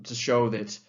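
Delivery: ordinary talk, nothing marked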